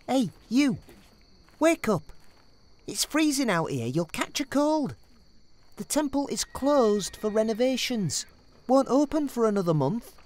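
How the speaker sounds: loud call to wake him. Acted. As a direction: shout to rouse a sleeper. Calling to a sleeper.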